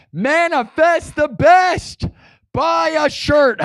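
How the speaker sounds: high, slow slogan call; hype slogan. Acted. Streamer pitching his shirt slogan, high and slow. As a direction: sell it like a chant.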